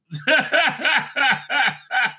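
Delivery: long, high cackling laugh; real laughter. Real, not acted. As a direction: burst out laughing and keep it rolling. Laughing at own joke.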